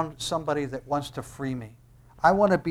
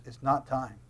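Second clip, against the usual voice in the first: softer, understated reply; calm refusal; 3 semitones lower, 6 dB quieter than usual. Acted. Jesus answering his brothers, calm and soft. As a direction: decline quietly. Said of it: "And Jesus says".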